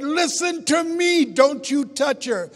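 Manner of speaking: loud, slow, furious warning; angry command. Retold or imitated. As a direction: lay down the law to your brother. Angry at brother over mother.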